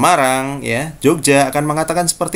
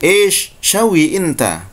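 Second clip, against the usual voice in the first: slow Saudi "ish shawi inta", a higher pitched "Ish" to open; Saudi Arabic; 4 semitones higher, slower than usual. Shown as a demonstration. Teacher showing students the Saudi way to ask. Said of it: "orang Saudi akan mengatakan seperti itu".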